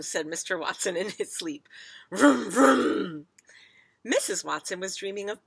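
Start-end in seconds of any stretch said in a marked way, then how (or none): 2.1-3.3 loud double rev, higher pitched; car engine noise. A narrator's reading. Father revs a dream car in his sleep, engine noise. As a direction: rev the engine twice, loudly. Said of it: "dreaming of driving a very fast car".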